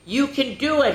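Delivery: high, bright cheer; rally a friend with a cheer. Acted. Friends cheering Liam up, high and bright. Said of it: "his friends cheered".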